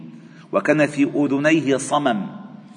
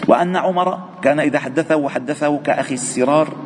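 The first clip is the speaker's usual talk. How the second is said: usual speaking voice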